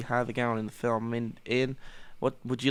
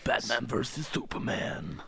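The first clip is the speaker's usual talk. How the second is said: slow, softer, dramatic trailer announcer; movie trailer voice; 5 dB quieter, slower than usual. Shown as a demonstration. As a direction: deliver it like an epic film trailer. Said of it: "No, it's like".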